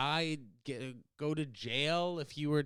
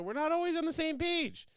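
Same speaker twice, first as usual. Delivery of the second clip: mock sing-song. High comic aside on geopolitics.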